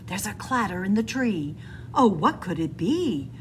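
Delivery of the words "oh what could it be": ordinary delivery, nothing marked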